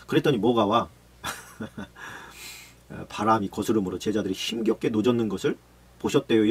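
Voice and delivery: ordinary talk, nothing marked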